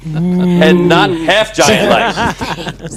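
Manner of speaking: high scornful cackle, then a slow laughing sneer; mocking laugh. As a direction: laugh in his face. Bully mocks Hogar.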